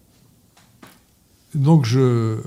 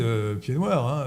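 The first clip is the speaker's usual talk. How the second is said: ordinary talk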